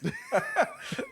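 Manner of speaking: high squealing laugh. Real, not acted. Laughing at angry TikTok.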